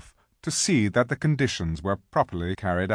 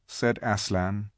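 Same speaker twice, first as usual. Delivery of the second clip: ordinary delivery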